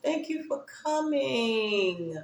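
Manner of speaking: soft, slow and fond; a gentle thank-you to a dear friend; tender goodbye. Real, not acted. Thanking viewer Renee at stream end, soft and tender.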